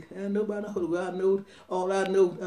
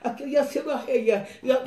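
re-enacted burst of tongues, louder; speaking in tongues; 5 semitones higher, 3 dB louder than usual. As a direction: pour out syllables in a fervent retelling. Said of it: "I was in another language".

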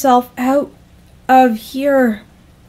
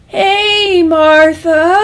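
high, drawn-out, strained casual greeting; stretched, loud, falsely breezy hello; forced calm; 8 semitones higher, 7 dB louder, much slower than usual. Acted. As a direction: try too hard to sound calm. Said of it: "he said as calmly as he could".

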